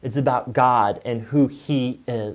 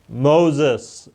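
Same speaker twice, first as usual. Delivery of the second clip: calling slow and a bit louder, a voice from the bush; booming divine call; God voice; 4 dB louder than usual. Acted. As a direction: call his name like God from the fire. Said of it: "that's my God voice".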